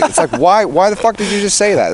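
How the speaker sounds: high, loud irritated question; exasperated. Retold or imitated. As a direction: demand it in disbelief. Retold exasperation.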